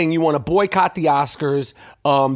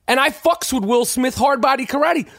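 ordinary talk, nothing marked

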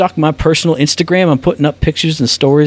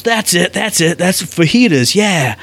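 higher-pitched mock eureka; imitated delighted diner; 4 semitones higher, slower than usual. Canadian diner hearing the waitress name fajitas, imitated delight. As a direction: imitate someone thrilled to recognise the word. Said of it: "He goes".

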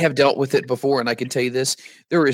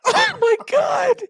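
high-pitched laughing disbelief; slower than usual. Real, not acted. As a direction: laugh through the words, slowly. Laughing at the commercial.

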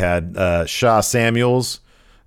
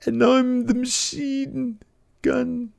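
very high, slow, drawn-out voice; Eddie Dennis; slower than usual. Acted. Pack leader rattling off nonsense, high drawn-out mock voice. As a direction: stretch each word out in a high put-on voice. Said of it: "Eddie Dennis says something like".

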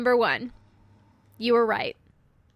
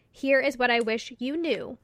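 normal talking voice

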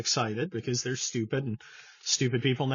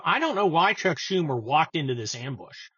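usual speaking voice

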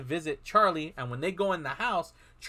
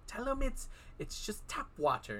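hushed aside; 7 semitones higher, 9 dB quieter than usual. Retold or imitated. Actor coaching the kids about holy water, hushed aside. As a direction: imitate a quiet word passed on the sly.

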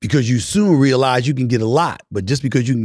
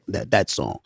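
ordinary talk

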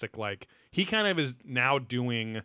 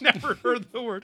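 laughing; 12 semitones higher, 3 dB louder than usual. Real, not acted. Laughing at the word.

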